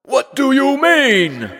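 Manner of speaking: very high, loud, slow demand; monster roar. A narrator's reading. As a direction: roar the question. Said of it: "roared the monster".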